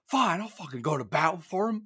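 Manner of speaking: high-pitched, exasperated mock outburst; imitated rage. Host imitating Jerry West in the flashback, mock rage. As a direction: act out a grudging, fed-up rant. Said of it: "get into a rage".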